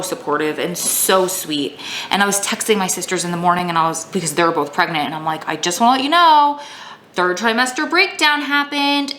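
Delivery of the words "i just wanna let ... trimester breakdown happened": high-pitched, sing-song heads-up; mock announcement. Texting pregnant sisters about a breakdown, mock announcement. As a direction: pitch it up to break news lightly.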